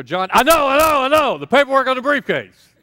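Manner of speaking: loud, high, fed-up "I know, I know"; exasperated outburst. Retold or imitated. Husband cutting off the nagging reminder, loudly exasperated. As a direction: blast it out like you've heard it too often.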